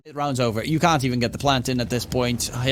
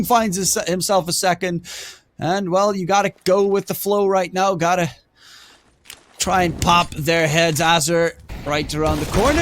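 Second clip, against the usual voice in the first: fast, rising live play-by-play; esports caster; 3 semitones higher, 3 dB louder than usual. Acted. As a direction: call the action as it happens.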